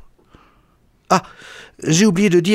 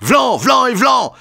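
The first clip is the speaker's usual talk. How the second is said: high shouted slap sounds; slap noises; 11 semitones higher, 3 dB louder than usual. A narrator's reading. Narrator mimics slaps raining on the lecturer, high shouted blows. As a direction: fire off each blow as a high shout.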